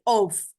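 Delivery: ऑफ said with a clear f sound, like off; ऑफ spoken slowly and clearly as a model word. Shown as a demonstration. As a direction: say ऑफ ending in a crisp f. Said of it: "इसकी pronunciation होती है ऑफ".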